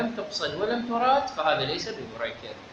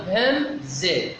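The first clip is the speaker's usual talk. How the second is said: very slow, syllable-by-syllable "همزة"; spelling out; 3 semitones higher, much slower than usual. Shown as a demonstration. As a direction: stretch "همزة" out as if spelling it for students. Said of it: "حال تهجيها بقولنا".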